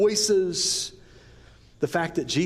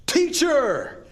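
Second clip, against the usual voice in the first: high, loud cry of joy; joyful yell; 12 semitones higher, 3 dB louder than usual. Retold or imitated. Mary shouting to Jesus in joy, loud. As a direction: shout it out like she just saw him. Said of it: "She yelled at the top of her voice".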